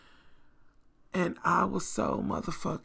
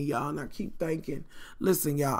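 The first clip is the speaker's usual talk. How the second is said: normal talking voice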